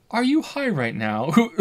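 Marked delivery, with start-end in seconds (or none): 1.3-1.6 laughing through the word; cracking up mid-question. Real, not acted. Laughs during line.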